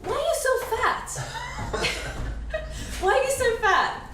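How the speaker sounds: tailor's blunt question, imitated high and slow; imitating the tailor. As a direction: squeak out the tailor's line, laughing. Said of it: "she just stops and she goes".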